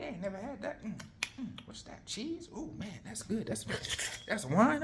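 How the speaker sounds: high-pitched, easygoing delight with little chuckles; chilling, enjoying himself. Acted. Mordecai relishing his newfound riches, chilling. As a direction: play a guest savoring every new treat. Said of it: "Mordecai chilling, he like".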